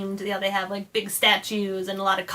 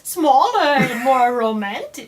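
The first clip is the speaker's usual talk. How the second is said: slow words through laughter; laughing; 5 semitones higher, 7 dB louder, slower than usual. Real, not acted. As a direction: giggle through the defense. Laughing defense.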